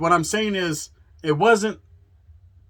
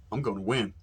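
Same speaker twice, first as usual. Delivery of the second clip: low, much softer and calm, no aggression; total confidence; 7 semitones lower, 7 dB quieter than usual. Retold or imitated. Retelling Rojo's calm promise to win, low and quiet. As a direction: state it quietly like it's a fact. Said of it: "is like this".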